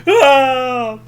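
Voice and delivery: long, loud, high yell; mock terrified scream. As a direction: scream as if about to be hit. Both scream before being hit.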